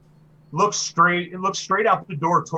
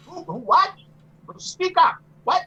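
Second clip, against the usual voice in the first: put-on high-pitched, hard-of-hearing old lady; querulous old woman demanding to hear; 4 dB louder than usual. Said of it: "she sounds old".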